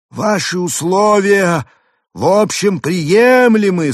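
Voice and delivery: high, slow, loud alien; drawn-out grand alien. A narrator's reading. Arthur's alien voice. (высокий медленный голос пришельца)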